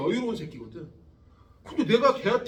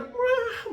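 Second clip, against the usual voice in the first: high mock rock wail; imitated rock wail; 14 semitones higher, much slower than usual. Teacher imitates Queen's singing to students, high mock wail. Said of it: "막 이러는 거야".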